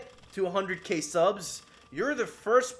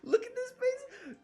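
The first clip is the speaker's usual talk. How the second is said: much higher, strained, laughing squeal; squealed laugh-cry; 5 dB quieter than usual. Real, not acted. Streamer showing the wrecked village, laugh-crying squeal. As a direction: wail it through a laugh.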